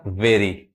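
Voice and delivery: वेरी as 'VE-ree', closed 'e'; taught 'e' vowel. Shown as a demonstration. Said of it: "यहाँ पे ए आ रहा है". (वेरी में 'ए' बोलें, 'ऐ' नहीं)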